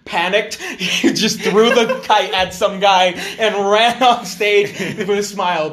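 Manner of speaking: words broken by real laughter; laughing. Real, not acted. Laughing through the kite story.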